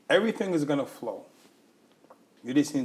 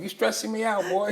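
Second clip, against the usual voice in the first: a higher, put-on voice for her son; mock son voice; 7 semitones higher than usual. Host imitates the son scolding his mother, higher put-on voice. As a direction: tease her like her son would.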